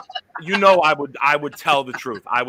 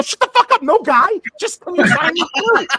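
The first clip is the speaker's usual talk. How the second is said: much higher, louder mock yell; mock shouted outburst; 14 semitones higher, 4 dB louder than usual. Host retelling cutting off the kid, mock yell. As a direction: blow up at the kid in jest.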